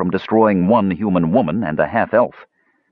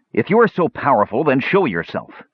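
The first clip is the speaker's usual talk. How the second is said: higher, pressing challenge; insistent demand; 7 semitones higher than usual. A narrator's reading. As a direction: repeat it firmly as a challenge. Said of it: "Tanis repeated".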